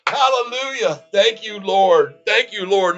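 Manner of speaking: high, loud mock-preacher cheer, slowed on the first thank you; joyful praise. Real, not acted. Trader thanking the Lord for his rising stock, ecstatic. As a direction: lift it up like a revival shout. Praising God for price spike.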